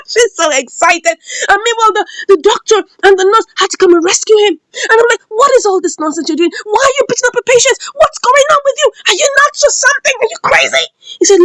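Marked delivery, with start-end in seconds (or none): 5.3-10.8 high, quick mock scolding; outraged scolding. Onlooker to the man beating a patient, outraged scolding. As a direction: fire off indignant questions, pitch climbing.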